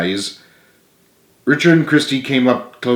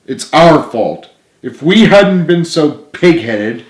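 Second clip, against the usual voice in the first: earnest pleading friend; 5 semitones higher, 5 dB louder than usual. Acted. Richard pleads with sobbing James.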